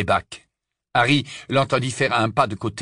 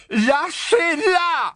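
high desperate cry; anguished high cry of Ron; much slower than usual. A narrator's reading. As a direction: scream it, stretched and pleading. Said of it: "s'écria Ron".

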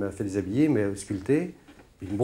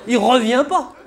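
high, loud comic indignation; incredulous high-pitched yell; mock exasperation; 12 semitones higher, 7 dB louder than usual. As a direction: shout at the absurdity of it. Mock outrage, absurd plumber example.

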